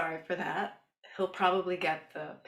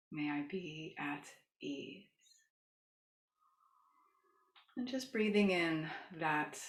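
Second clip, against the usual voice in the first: soothing yoga teacher. Shown as a demonstration. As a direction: gently lead a loving kindness meditation. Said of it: "as I start to use my yoga voice".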